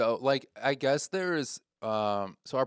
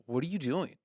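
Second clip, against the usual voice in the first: mildly upset; 4 semitones higher than usual. Retold or imitated. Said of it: "my friend gets upset".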